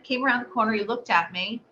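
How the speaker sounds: ordinary delivery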